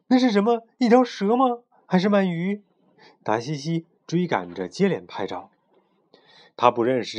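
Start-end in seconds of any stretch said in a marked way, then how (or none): 0.1-2.6 high, louder, astonished questions; startled wonder. A narrator's reading. Photographer spots a strange big fish, startled wonder. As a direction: puzzle aloud in excited surprise.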